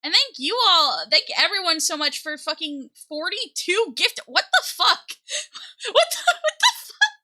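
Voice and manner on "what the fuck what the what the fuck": very high, squealed disbelief with laughter; stunned outburst leaping into a squeal; shocked disbelief. Real, not acted. Stunned by forty-two gifted subs.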